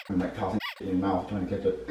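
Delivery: normal talking voice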